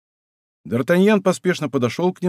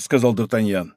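plain reading voice

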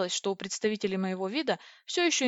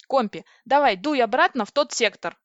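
loud, higher, urgent order; excited command; 4 semitones higher, 8 dB louder than usual. Acted. Heroine ordering Kompi back to the sector, excited. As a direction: send them off with gusto.

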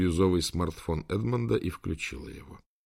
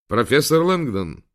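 higher, louder formal greeting; lightly voiced AI assistant; 7 semitones higher, 7 dB louder than usual. A narrator's reading. AI assistant greeting the professor on speakerphone, proclaiming. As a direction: proclaim the name brightly. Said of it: "провозгласил знакомый голос с британским акцентом".

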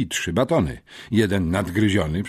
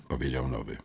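plain reading voice

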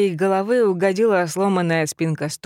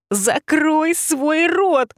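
very high, very slow screeching creature voice; furious rabbit shriek; 10 semitones higher, much slower than usual. Acted. Rabbit shrieking at the teasing heroine. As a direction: screech each word out in rage.

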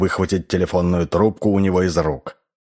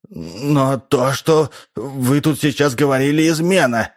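agitated protest; 7 semitones higher than usual. A narrator's reading. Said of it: "не унимался Честер".

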